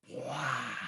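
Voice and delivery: usual speaking voice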